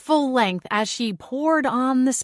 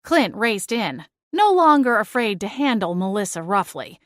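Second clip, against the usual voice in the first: ordinary delivery, nothing marked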